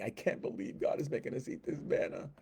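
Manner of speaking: a hushed, hammed-up moan about the manna; mock whiny whisper.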